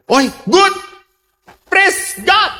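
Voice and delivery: high, exclaimed praise; acted rejoicing. Pastor hearing she will marry, acted rejoicing.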